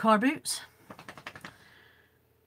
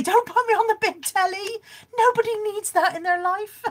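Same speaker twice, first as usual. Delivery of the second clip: laughing protest; 11 semitones higher, 6 dB louder than usual. Real, not acted. Protesting being on big telly.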